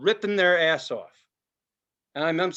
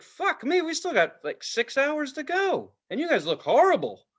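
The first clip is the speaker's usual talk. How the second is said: mock-exasperated visitor; 9 semitones higher than usual. Quoting a worn-out park visitor.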